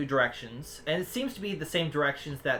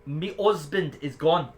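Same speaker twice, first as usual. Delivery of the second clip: attempted Jamaican accent. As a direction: play her with a wobbly Jamaican accent. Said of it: "You're going with the Jamaican accent".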